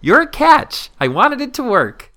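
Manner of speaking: very high, loud, drawn-out quoting; mocking read-aloud. Friend reading the kind brush-off aloud, gleeful mockery. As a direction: blare the compliment as if appalled.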